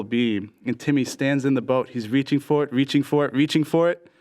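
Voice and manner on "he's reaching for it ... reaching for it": repeated phrase climbing in pitch; storyteller winding up to the punchline; building suspense. A narrator's reading.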